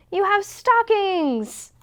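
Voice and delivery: high, slow, delighted cry; excited. A narrator's reading. Mouse pointing at the mantel, high delighted cry. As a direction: squeal with joy at the discovery.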